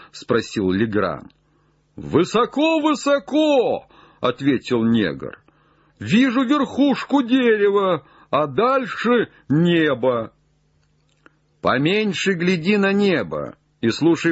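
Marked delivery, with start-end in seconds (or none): 2.0-3.9 very high, stretched-out calling voice; distant call. Acted. Jupiter answers Legrand from high up, high distant call. 6.0-10.3 high, sing-out treetop call; distant call. Acted. Jupiter reports to Legrand from the treetop, high distant call.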